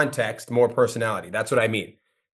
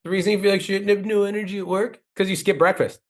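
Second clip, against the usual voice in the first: higher, punchy read of the hook line; reading the hook with more personality; demo read; 6 semitones higher than usual. Shown as a demonstration. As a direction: show how the script should land. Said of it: "you could literally be like".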